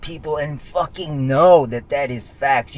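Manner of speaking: high, loud and fired up, half-singing the words; heated loud boast. Real, not acted. To viewers, that no caddy beats his obsession, loud and high. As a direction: hammer every line home like a hype speech.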